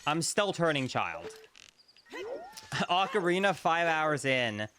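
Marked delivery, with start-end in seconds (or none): 2.1-2.8 high laugh. Real, not acted. Laugh at five hours.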